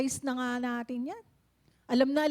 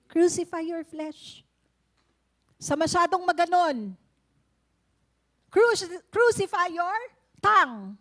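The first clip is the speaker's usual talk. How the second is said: forceful preaching; 2 semitones higher, 6 dB louder than usual. Real, not acted. Preacher exhorting crucify your tongue.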